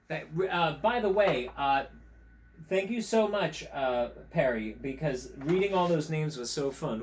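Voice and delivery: usual speaking voice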